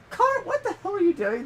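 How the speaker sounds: high, loud parental outcry; hammed-up exasperation; mock exasperated yell. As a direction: holler at the kid in comic disbelief. Acting out scolding the noisy boy.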